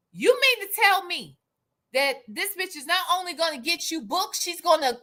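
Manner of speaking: normal talking voice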